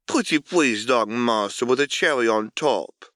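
high, sugary coy pleading; girlish, teasing plea to her dark master; playing coy. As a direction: bat your eyelashes with your voice. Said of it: "She batted her eyelashes and played coy".

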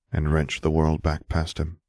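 ordinary delivery, nothing marked

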